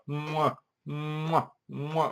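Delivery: soft, exaggerated "mwah" kisses; little air-kiss sounds, one by one; mock kissing noises. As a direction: smooch noises, hammed up. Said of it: "all these people just go".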